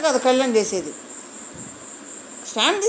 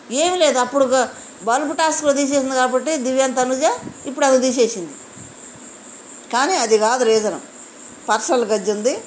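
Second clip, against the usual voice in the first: ordinary talk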